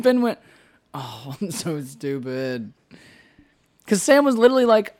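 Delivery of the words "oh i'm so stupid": very slow and softer; a hint of a mortified friend; mock embarrassed groan. Ben's embarrassed groan quoted.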